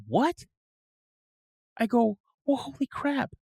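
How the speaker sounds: retold shock, pitched high; stunned disbelief. Son hears of a playmate's death, high retold shock.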